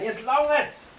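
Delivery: high-pitched acted exasperation; fed-up dwarf. Dwarf has had enough.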